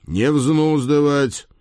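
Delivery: loud, slow stern command; stern order. Acted. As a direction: lay down the law. Ordering stable boy.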